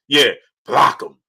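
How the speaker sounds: slow, cocky big-ego delivery. Pretend. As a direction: lean back and dismiss him like a big shot. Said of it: "block him in my ego voice".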